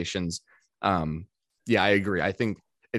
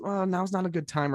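reluctant brush-off; 10 semitones higher than usual. Retold or imitated. Voices the opposite, reluctant response.

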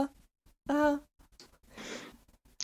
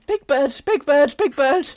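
loud frightened shouts of alarm; panicked yelling; 9 dB louder than usual. Acted. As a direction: shriek it in a panic. [name] startled by landing eagle.

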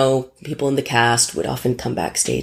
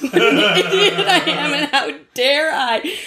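much higher, laughing at herself; 10 semitones higher than usual. Real, not acted. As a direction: laugh in self-mockery, slowing at the end. Laughing at own behaviour.

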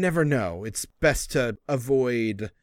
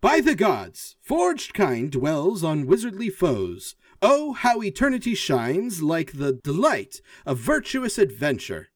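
higher, grand recital of a poem; robot reciting poem; 4 semitones higher than usual. Acted. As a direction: declaim it like a proud machine poet.

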